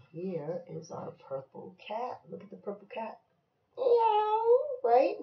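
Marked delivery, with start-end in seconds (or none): 3.8-4.4 very high drawn-out meow; high-pitched cat cry; cat imitation. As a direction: mew like a little kitty. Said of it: "What's the cat say?".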